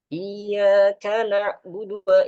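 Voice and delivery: hurried iyyaka na'budu cut short; rushed recitation. Shown as a demonstration. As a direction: rush the verse. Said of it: "kayak cepet tuh".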